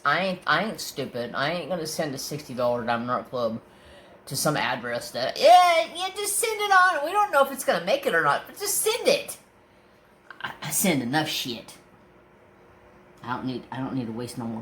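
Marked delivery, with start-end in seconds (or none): 5.4-9.4 much higher, careless mock voice, fast in the middle; mocking the clerk. Mocking the clerk who wants to ship anyway, high careless voice. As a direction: ape the dismissive clerk.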